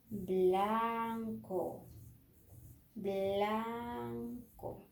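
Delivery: "blanco" very slowly, with a long "aaan"; sounding out "blanco". Shown as a demonstration. Teacher gives B-L colour example, sounding it out. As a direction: stretch "blanco" to show B and L joined. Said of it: "B L, por ejemplo".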